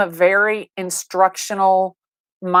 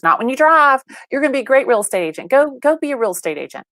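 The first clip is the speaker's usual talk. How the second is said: imitated mom, higher and urging; pitched-up motherly pep talk; insistent mother; 6 semitones higher than usual. Mom pushing her daughter into real estate, insistent.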